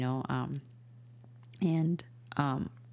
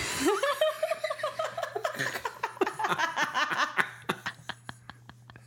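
high delighted laugh. Real, not acted. Laughing at son's answer.

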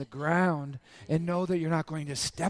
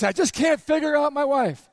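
high, loud mock complaint; acted exasperation; 7 semitones higher, 6 dB louder than usual. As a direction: play an exasperated husband, voice pushed up. Acts out a baffled husband complaining.

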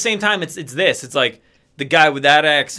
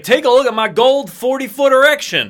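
higher, louder ad pitch; Brad Benson impression; 6 semitones higher, 3 dB louder than usual. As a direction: show off like a car dealer. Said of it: "he's like".

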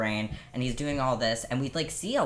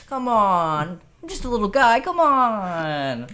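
high, whiny pleading; whiny little guy voice; 9 semitones higher, 8 dB louder, slower than usual. Retold or imitated. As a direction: beg like a pitiful little guy. Echoing the pleading Herbert voice.

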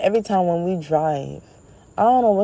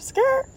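high tire screech. Retold or imitated. Imitating a car swerving.

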